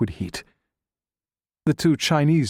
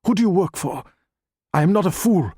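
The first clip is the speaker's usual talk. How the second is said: higher, louder, indignant challenge; angry demand; 8 semitones higher, 3 dB louder than usual. A narrator's reading. Doctor Ma challenges the stranger, raised and indignant. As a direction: refuse to be fooled.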